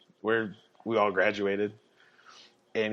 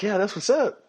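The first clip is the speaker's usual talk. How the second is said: much higher, upbeat cop voice; 11 semitones higher, 4 dB louder than usual. Retold or imitated. Officer chats about football with the driver, much higher and upbeat. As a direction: sound impressed and casual.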